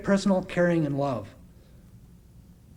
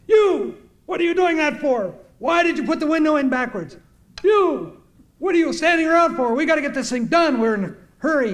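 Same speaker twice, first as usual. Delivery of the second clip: high, loud scolding of workers; imitated yelling; 11 semitones higher, 5 dB louder than usual. Him yelling at people working on the bus, imitated yelling. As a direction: fire off sharp orders as the boss. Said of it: "He's just yelling at people".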